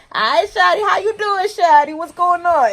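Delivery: very high, sing-song hello; exaggerated cheerful greeting. Replying to her coworker in front of the manager, high sing-song greeting. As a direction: ham up the friendliness on purpose. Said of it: "And I was like".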